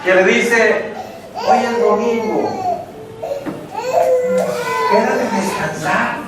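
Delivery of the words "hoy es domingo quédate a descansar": coaxing inner voice. Acted. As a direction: lull them into staying home, stretching the words. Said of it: "usted escuchó una vocecita que le dice".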